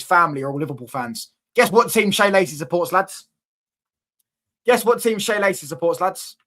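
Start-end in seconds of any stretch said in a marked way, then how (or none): 1.5-3.3 higher, louder taunting question; teasing quiz call. Real, not acted. 4.7-6.4 higher, louder taunting question; teasing quiz call. Real, not acted. Teasing chat, question repeated.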